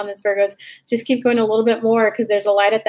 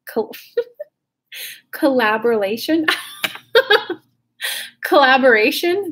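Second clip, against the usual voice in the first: laughing through a tongue-twisted word, pitched up; giggling mispronunciation of "collaboration"; laughing stumble; 3 semitones higher than usual. Real, not acted. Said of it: "There we go".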